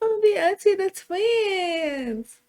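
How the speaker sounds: high-pitched, gushing delight; thrilled auntie-to-be. Acted. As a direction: squeal the good news, voice way up. Learning she'll be auntie to twins.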